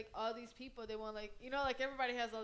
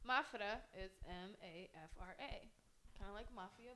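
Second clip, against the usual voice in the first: usual speaking voice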